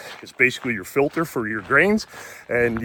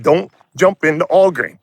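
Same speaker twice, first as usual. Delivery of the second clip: emphatic; 5 semitones higher, 6 dB louder than usual. Retold or imitated. Advising friend.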